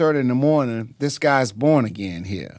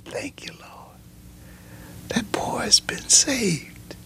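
hushed reverent whisper; awed whisper; 9 semitones higher, 7 dB quieter, slower than usual. Retold or imitated. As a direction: breathe it out in thankful awe. Father awed by son's conversion.

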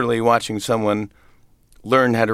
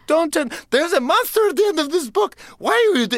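very high, rushed Muppet-monster plea; frantic Grover. Retold or imitated. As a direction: squeal the warning high like the frightened monster.

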